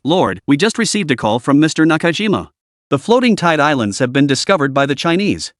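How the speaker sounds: ordinary delivery, nothing marked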